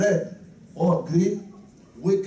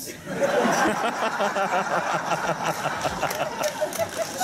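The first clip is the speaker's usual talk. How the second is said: breathy laugh; 5 semitones higher than usual. Real, not acted. Laughing at own story.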